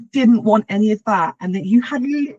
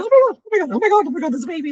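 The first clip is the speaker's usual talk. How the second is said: tearful disbelief; 8 semitones higher, much faster than usual. Retold or imitated. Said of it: "Lucas is crying".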